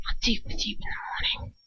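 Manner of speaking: whispered menacing threat; cold threat. A narrator's reading. Father whispering a threat to his son. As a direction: hiss the promise of punishment.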